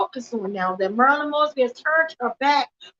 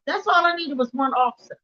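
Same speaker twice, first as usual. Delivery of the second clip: usual speaking voice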